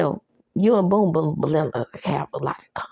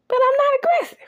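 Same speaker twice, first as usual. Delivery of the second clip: high mock woman's voice; 3 dB louder, faster than usual. Woman quoted in a recap.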